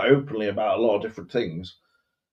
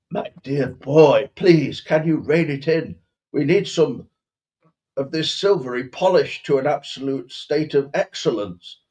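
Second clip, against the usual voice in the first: faux posh accent; 3 semitones higher than usual. Posh master telling the boy to polish, faux posh. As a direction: rein him in with mock-posh elocution. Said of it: "but faux posh".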